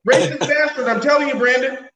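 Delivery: mock outrage. Mock outrage at friendly cop.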